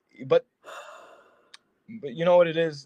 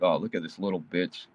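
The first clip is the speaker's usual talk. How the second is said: lower, sneering mock girl; a lower, dismissive imitation of a woman; 7 semitones lower than usual. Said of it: "they're like".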